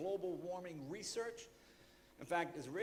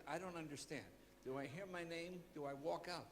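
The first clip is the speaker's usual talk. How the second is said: softer, puzzled questioning; meek confusion; 8 dB quieter than usual. Retold or imitated. Confused host asks the director about the cue, meek and soft. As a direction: ask it small and lost.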